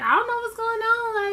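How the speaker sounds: high, giggly mock of a nervous woman; giggly Angela. Blindfolded Angela giggling to Tony, high and giddy. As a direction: play her giddy and blindfolded. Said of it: "feeling real, like, giggly".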